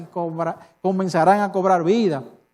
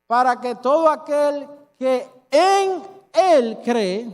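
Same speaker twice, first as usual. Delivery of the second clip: solemn verse reading; 3 semitones higher, slower than usual. Shown as a demonstration. Preacher reads the verse slowly to the congregation. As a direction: read the verse out slowly, savouring each word. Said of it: "El verso dice".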